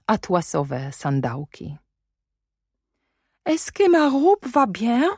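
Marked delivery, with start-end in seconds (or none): 3.5-5.0 put-on high, loud, chirpy child's voice; French little girl. A narrator's reading. Adèle asking her guardian about her dress, high and eager. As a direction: show off the new dress to him. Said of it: "zawołała".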